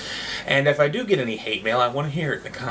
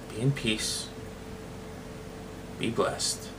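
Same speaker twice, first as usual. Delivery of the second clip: softer, fading sign-off; soft blessing; 4 semitones lower, 11 dB quieter, slower than usual. Real, not acted. Vlogger blesses viewers at the end, softly. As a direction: bless them quietly as you go.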